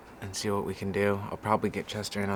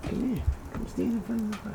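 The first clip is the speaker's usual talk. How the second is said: high pet call; 11 semitones higher, 3 dB quieter than usual. Real, not acted. Calling dog to fire.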